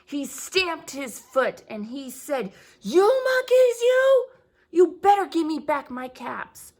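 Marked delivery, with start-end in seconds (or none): 2.8-6.7 loud high yell, easing into a firm demand; yell, then demand them back; angrier peddler. A narrator's reading. Peddler stamping his foot at the monkeys, angry yell. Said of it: "Now he felt quite angry".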